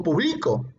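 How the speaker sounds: "publico" stressed on BLI; stress on middle syllable. Shown as a demonstration. Teacher showing how accent changes words, stress on BLI. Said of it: "intensidad aplicado a las sílabas".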